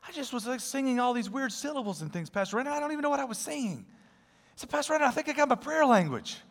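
high, giddy retelling of the moment; excited and beaming. Retold or imitated. Ed tells his pastor he got a prayer language, beaming. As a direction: let the words spill out, bright with wonder. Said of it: "he's all excited, he's beaming".

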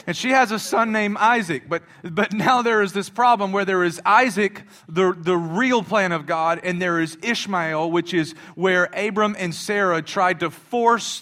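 fiery sermon point; preaching. Real, not acted. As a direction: build it up like the pulpit's on fire. Preaching at full force.

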